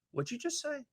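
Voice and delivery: high, soft little voice; interrupting listener. Acted. A listener asking the host to repeat, high and soft.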